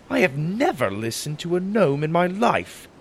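high-pitched, affronted protest; offended, pitch jumping up; indignant denial. Acted. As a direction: protest as if insulted. Hero indignantly denies the accusation.

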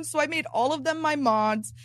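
usual speaking voice

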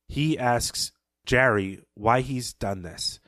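normal talking voice

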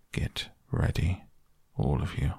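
quiet urgent command before the charge; hushed whisper. Acted. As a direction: whisper the order, barely voiced. Badger readies friends before attack.

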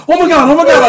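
high, loud mock panic; the rookie seeing the car roll; panicked. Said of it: "and I'm like".

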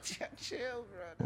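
high, half-joking chill bro; mock calming. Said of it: "I was like".

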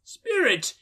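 high anguished cry; Scrooge crying out. A narrator's reading. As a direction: call out to the spirit in dread. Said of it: "he cried".

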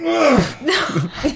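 disgusted groan. Retold or imitated. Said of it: "And I go".

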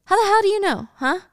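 high, agitated challenge; angry retort. Retold or imitated. Danny snaps back at Wendy.

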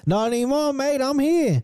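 very high hammed-up Australian greeting; mock Aussie accent. Friend mocking lonely streamer's Australian persona, very high. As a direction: chirp it like a cheery Aussie mate. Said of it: "develop multiple people to talk to".

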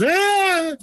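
put-on high sustained child's wail; mock crocodile-tear wail; wailing cry. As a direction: let out a long childish wail. Crying as a child.

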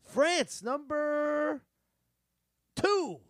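rising, drawn-out announcement; announcing. Real, not acted. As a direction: announce it like a game show host. Revealing quiz answer.

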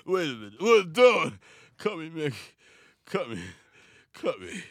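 slurred boxer impression; punch-drunk plea, in short bursts; Stallone. Boxer begging his cornerman to cut him, slurred impression.

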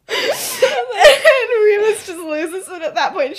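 high, slow, loud, breaking into laughter; laughing hard. Real, not acted. Storyteller cracking up at the funny moment, laughing hard. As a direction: lose it while telling.

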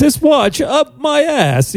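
high, slow, stilted delivery; Christopher Walken impression. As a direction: stiff halting Walken voice. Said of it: "it sound too stilted".

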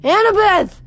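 high, loud yell; yelled name. A narrator's reading. Boy desperate for fallen friend, yelling. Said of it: "I yelled".

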